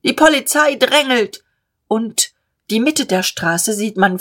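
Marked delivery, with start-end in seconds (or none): 0.0-1.4 high, raised alarm from the crowd; higher-pitched protest shout; crying out. Acted. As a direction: call out a complaint in the crush. Cry from the crowd.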